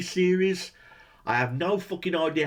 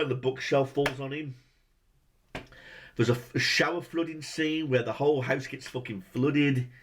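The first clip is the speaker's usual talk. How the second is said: usual speaking voice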